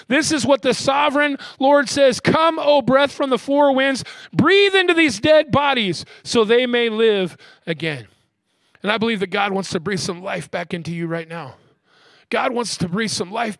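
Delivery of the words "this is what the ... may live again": loud, high prophetic proclamation; commanding scripture declaration; preaching at full force. Real, not acted. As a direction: call the scripture out with mounting force, slowing into the last words.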